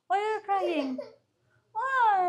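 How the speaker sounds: high-pitched mommy talk. Real, not acted. Mother talks to crying baby.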